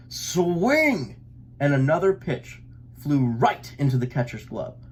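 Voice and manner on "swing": hollering. A narrator's reading. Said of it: "hollered Papa".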